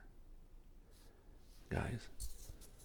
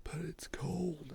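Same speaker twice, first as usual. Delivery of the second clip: high, drawn-out mock complaint; reluctant kid; 8 semitones higher, slower than usual. Kid forced to go caroling, in a high, slow mock whine. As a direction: imitate a kid dragging out a protest.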